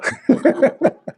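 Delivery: short high-pitched laugh; amused laugh mid-story. Real, not acted. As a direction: burst into a bright little laugh. Laughs while quoting the Lord.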